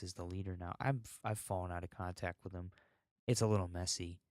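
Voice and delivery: ordinary talk, nothing marked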